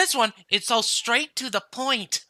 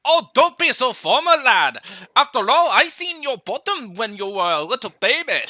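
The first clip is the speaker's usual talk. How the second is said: teasing Scottish bluster; Scottish accent. Acted. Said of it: "trying to do a Scottish accent".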